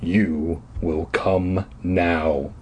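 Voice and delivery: low, slow villain voice; deep menacing repeat. A narrator's reading. Caped man repeats his order, deep and slow. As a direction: lean on every word, slow. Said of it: "he repeated".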